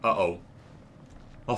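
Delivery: ordinary talk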